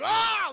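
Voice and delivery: high startled yelp of a man seeing a monster; mock scream. Chad sees the blob and shrieks in fright. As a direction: shriek it out, jolted.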